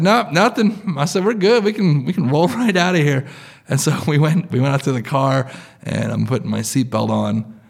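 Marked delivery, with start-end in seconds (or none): 0.0-0.9 breezy denial. Retold or imitated. Hurriedly brushing off worried family. 1.2-3.3 breezy reassurance. Retold or imitated. Hurriedly brushing off worried family.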